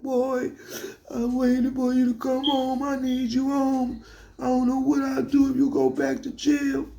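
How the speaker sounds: very high, thin mock-crying grandma; imitated crying. Grandmother crying for grandson to stay home, high mock wail. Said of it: "my grandma start crying".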